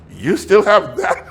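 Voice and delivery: a laughing, incredulous shout at the kept cup; amazed disbelief. Retold or imitated. Preacher to Honduran hosts, laughing amazed shout. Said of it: "I'm going like".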